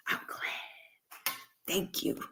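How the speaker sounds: whispered, breathy and soft; a playful whisper of thanks. Pretend. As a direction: drop it to a hushed whisper. Fading to a whisper.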